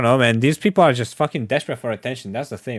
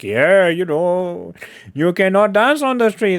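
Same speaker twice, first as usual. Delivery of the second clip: slow, higher mocking repeat; 5 semitones higher, slower than usual. Mocking the Iranian man's words, slow and higher. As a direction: drag it out to mock him. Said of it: "It was like".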